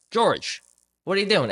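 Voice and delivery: high, incredulous call up the ladder; a boss shouting up at a young worker; disbelieving boss yelling his name. Retold or imitated. Boss calls out to George.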